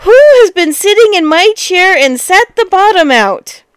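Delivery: high, loud little bear outrage; angry tiny voice. A narrator's reading. Tiny bear with a broken chair, teeny tiny angry voice. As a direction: squeal it as a furious tiny bear. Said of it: "cried out in a teeny tiny voice of anger".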